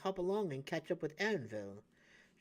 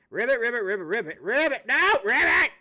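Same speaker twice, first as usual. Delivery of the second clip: loud, higher panicked ribbits; frantic croaking; 5 semitones higher, 11 dB louder than usual. Pretend. Toad spotting the dragon, mock panic croaks.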